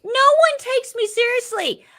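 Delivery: loud, very high-pitched complaint; comic, high-pitched exasperation; exasperated wail. Real, not acted. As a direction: protest at the top of your range. Complains after being laughed at.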